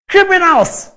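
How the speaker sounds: scornful shout. Real, not acted. Angry denunciation, exclaimed.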